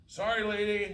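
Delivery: high, loud call back over the shoulder; called out apology. A narrator's reading. Skater boy calls back a loud sorry. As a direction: shout the apology back while rolling away. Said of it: "he says, calling back".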